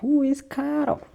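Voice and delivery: loud, high mock-accented Carl; hammed-up Scottish or Irish-style question; thick accent. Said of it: "it was so fucking thick".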